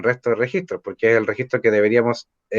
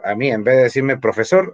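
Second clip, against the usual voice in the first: ordinary delivery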